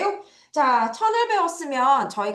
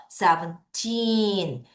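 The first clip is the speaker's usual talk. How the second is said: put-on Seventeen with a long, strong TEEN; seven-TEEEN, ending held; stress on TEEN; 3 dB quieter, much slower than usual. Shown as a demonstration. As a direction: stretch the last syllable of Seventeen. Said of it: "강하게 읽어주셔야 돼요".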